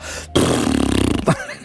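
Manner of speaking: lip-trill raspberry breaking into a chuckle; pedorreta. Retold or imitated. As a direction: blow a loose lip trill, then laugh. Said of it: "estaba [name] haciendo". (pedorreta con los labios seguida de risa)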